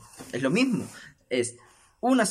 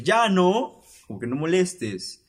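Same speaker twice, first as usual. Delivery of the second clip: loud, higher snap at the comedian; angry heckler; 6 semitones higher, 5 dB louder, slower than usual. Retold or imitated. Offended audience member snapping at Richo Farrell, loud and higher. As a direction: cut him off, offended. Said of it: "el pata se enoja y dice".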